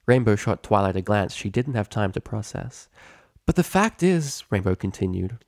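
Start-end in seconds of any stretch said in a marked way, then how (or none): 3.5-4.4 brash pegasus. Acted. Rainbow argues.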